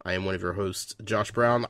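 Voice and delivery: ordinary talk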